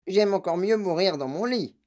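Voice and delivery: put-on old-woman wail; cried out. A narrator's reading. Grandmother refusing to board the elevator, crying out. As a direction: cry out a stubborn refusal. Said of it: "cria grand-maman Joséphine".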